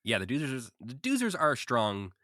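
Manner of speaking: usual speaking voice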